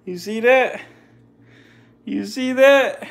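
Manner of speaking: high, laughing disbelief; excited high laugh. Real, not acted. Laughing to viewers after the snake's lunge. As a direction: laugh it off after the close call.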